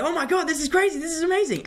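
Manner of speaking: high, giddy mock scream; excited screaming, retold. Actor reliving winning the role in bed, excited screaming. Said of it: "I was screaming and shouting".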